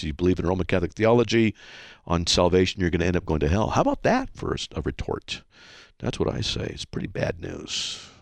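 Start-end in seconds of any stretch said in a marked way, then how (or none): 4.3-8.1 whispered, much softer aside. Real, not acted. Host commenting on a caller's retort, whispered. Host's amused aside on hate mail.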